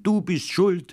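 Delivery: very high, wailing cry; sobbing child blaming his friend; crying accusation. A narrator's reading. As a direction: throw the blame through tears. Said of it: "hat immer geschrien".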